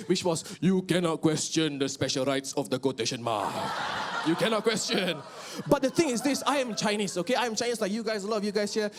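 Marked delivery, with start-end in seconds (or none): 0.6-5.2 official reply. Retold or imitated. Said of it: "standard Malaysian reply".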